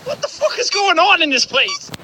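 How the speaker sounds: loud startled yell; shouting. Real, not acted. Gamer yelling at the jump scare, loud. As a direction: yell it out in a panic.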